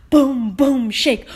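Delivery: loud booming footsteps; loud stomps. A narrator's reading. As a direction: make the ground shake. T-Rex footsteps nearest.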